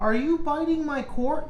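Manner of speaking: high, alarmed pet-talk. Real, not acted. Cat chewing streamer's cable.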